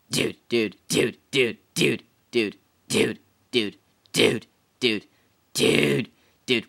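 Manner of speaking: short, forceful bursts of one word; rapid-fire repeated "dude". Pretend. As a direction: punch each one out until breathless. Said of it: "Fuck, I'm out of breath".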